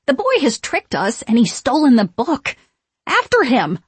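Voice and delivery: loud, high outraged witch; outraged command. A narrator's reading. Winifred to her sisters, outraged and commanding. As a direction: rage it, then order the chase. Angry witch ordering pursuit.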